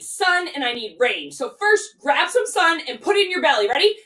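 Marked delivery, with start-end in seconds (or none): none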